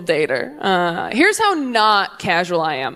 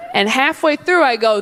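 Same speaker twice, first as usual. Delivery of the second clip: usual speaking voice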